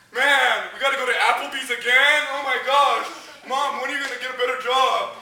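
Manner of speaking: put-on loud, whiny complaining kid; spoiled kid whining. Spoiled kid whining to mom about Applebee's, loud. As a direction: whine like a spoiled teenager. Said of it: "Some of you guys are like".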